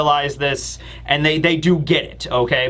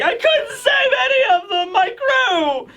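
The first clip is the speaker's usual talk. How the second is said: much higher, wailing cry of despair; bawling Luffy. Retold or imitated. Luffy on the ground grieving his lost crew, bawling shout. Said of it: "crying and bawling his eyes out".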